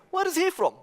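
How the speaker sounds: mock-curious busybody asking; nosy question. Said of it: "And they will ask you".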